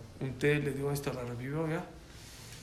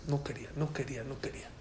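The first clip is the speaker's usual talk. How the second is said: whispered, repeated admission; hushed confession; 8 dB quieter, faster than usual. Retold or imitated. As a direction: fade into a reluctant whisper. Acted confession of reluctance.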